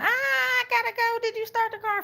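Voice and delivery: high-pitched frantic mimicry; panicked latecomer. Baby girl running late for work, high frantic imitation. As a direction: imitate her in a high, sustained whine. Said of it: "she gonna bring that stank ass in here tell me".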